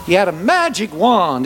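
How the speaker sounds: usual speaking voice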